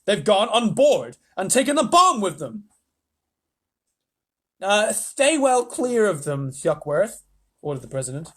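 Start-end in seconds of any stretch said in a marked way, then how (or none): 0.0-2.7 frantic voice. Acted. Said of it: "said the frantic voice of Shuckworth over the loudspeaker". 4.6-7.2 high, slow, loud odd voice; president voice. Acted. President orders.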